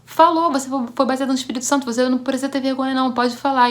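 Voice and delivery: aunt loudly insisting she spoke in tongues; insistent aunt. Retold or imitated. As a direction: urge her on loudly like an excited aunt. Aunt insists, voiced by speaker.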